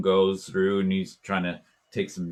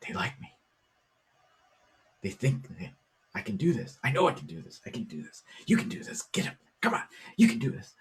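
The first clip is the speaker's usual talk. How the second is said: quiet self-talk; 6 semitones higher, faster than usual. Retold or imitated. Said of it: "saying it real quietly".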